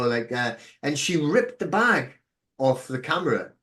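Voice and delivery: normal talking voice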